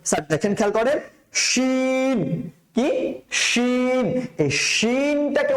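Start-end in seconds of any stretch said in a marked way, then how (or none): 1.3-2.5 শিন with a thick "sh" and held vowel; full-mouthed shin. Shown as a demonstration. Teacher introduces the next letter, full drawn-out শিন. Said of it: "এত করে আওয়াজ করবেন". 3.3-4.2 শিন with thick rushing "sh", long vowel; full-mouthed shin. Shown as a demonstration. Teacher shows the mouth full of sound, thick শিন. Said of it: "আওয়াজে ভরপুর হয়ে যাবে".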